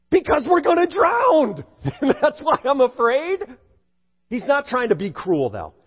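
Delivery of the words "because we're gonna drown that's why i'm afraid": high-pitched, incredulous retort; mock-panicked protest, pitched well up; acted exasperated panic. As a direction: answer back as a scared disciple stating the obvious. Acts disciples' exasperated answer to Jesus.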